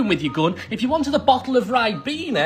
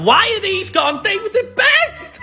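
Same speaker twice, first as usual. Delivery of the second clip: high wail, stretched at the end; anguished protest; 10 semitones higher than usual. Pretend. Snack fan mourning discontinued crisps, high anguished protest. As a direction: wail it in mock grief.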